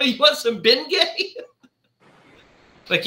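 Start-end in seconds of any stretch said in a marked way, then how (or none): 0.1-1.3 high, laughing mock wife; laughing imitation. Imitating his own wife offering Bengay, laughing.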